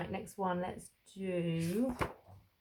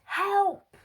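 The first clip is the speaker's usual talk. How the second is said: high loud cry of "Help!"; shout; 13 semitones higher, 11 dB louder than usual. A narrator's reading. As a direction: call out "Help!" as if lost far from home. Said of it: "and he shouts".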